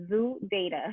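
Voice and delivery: Zoo data, said like Bronx Zoo plus data entry; name pronunciation demo. Shown as a demonstration. Teaching colleagues her name, Zoo data said as zoo plus data. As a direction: pronounce Zoo data as the word zoo followed by data. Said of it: "my name is pronounced like Bronx Zoo and data entry".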